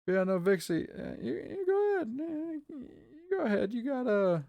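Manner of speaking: squeaky falsetto teasing a friend; lightly hammed-up high-pitched lady voice; mock high voice. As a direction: go way up high and prissy. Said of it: "mock high voice teasing Vixie".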